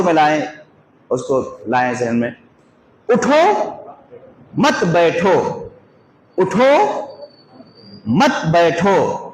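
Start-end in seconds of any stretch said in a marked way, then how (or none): 3.1-5.4 उठो raised, pause, then मत बैठो together; pause after उठो. Shown as a demonstration. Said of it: "खड़े होने की बात कर रहे हैं, बैठने से". 6.4-9.2 उठो, long pause, then slow मत बैठो; pause after उठो. Shown as a demonstration. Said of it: "खड़े होने की बात कर रहे हैं".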